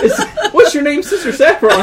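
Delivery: real laughter, high and loud; laughing. Real, not acted. Player laughing as he asks the card player's name. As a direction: ask through a fit of laughter.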